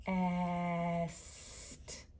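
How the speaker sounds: B-est split with the sounds drawn out; stretched-out word. Shown as a demonstration. Teacher sounding out best for children. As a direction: pull B-est apart slowly. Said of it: "writing the sounds I hear".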